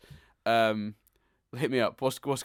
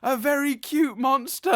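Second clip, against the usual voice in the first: high, slow, loud wail; mock yell; 12 semitones higher, 5 dB louder than usual. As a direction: draw out a hammy, horrified cartoon yell. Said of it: "he's yelling".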